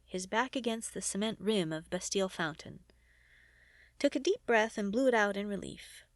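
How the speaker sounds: ordinary delivery, nothing marked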